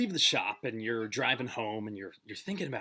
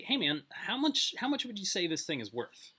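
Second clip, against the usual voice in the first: lightly acted, higher-pitched casual question; casual customer; 7 semitones higher than usual. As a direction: ask the shopkeeper casually, pitched a bit up. Customer asks the pawnbroker the price.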